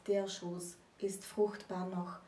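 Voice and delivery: slow, hushed recitation fading to a whisper; grave, slow quote that ends in a whisper; solemn quoted verse. Shown as a demonstration. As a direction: let the Brecht line sink into a dark whisper. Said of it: "wie Bert Brecht geschrieben hat".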